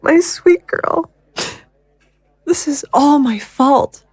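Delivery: high, slow, near-tearful lament; a mother breaking over her lost baby; grieving. Acted. Grieving mother blames herself.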